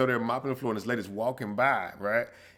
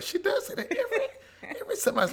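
mock listener; 3 dB quieter than usual. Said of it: "they will be like".

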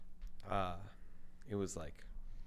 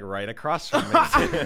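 laughing; 14 semitones higher, 4 dB louder than usual. Real, not acted. Realizes vomit is at his door.

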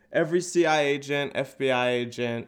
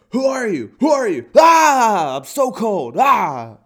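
very high, shouting, panicky little dog; frantic chihuahua; 10 semitones higher, 13 dB louder, slower than usual. Retold or imitated. Chihuahua shouting at a stranger, high and panicked. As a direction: shriek like a shivering chihuahua. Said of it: "That's what fucking Chihuahuas would sound like".

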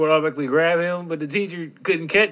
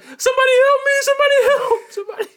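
very high, loud panicked cries with laughter; retelling the kid running down the hallway; mock panic; 7 dB louder, faster than usual. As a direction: squeal for help while being chased.

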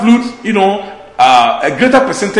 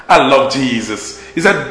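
ordinary talk

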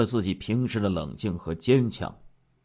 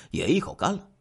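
ordinary delivery, nothing marked

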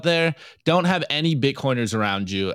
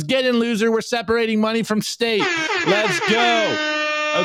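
higher, building to a shouted "Let's go"; hyped meme voice; 7 semitones higher than usual. Acted. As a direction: read the meme like a hype man. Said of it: "it goes".

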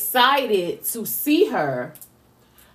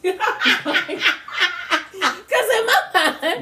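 laughing; 8 semitones higher than usual. Real, not acted. Laughing, recalling meeting her mother.

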